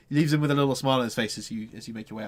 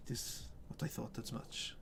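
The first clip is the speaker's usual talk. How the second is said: whisper; 15 dB quieter than usual. Acted. Father whispering, pained.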